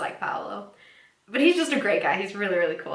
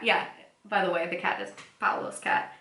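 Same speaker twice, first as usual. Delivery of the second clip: ordinary delivery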